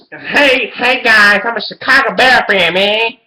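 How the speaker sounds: high, loud goofy fan voice; mock Bears fan. Mocking a Chicago Bears fan in a high goofy voice. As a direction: play a clueless fan greeting his viewers.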